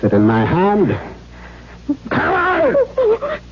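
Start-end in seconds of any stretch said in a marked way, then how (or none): 2.1-3.4 shrill furious order. Acted. Father forcing Katrine to hand him his stick, shrill shout. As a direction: shriek it, far higher.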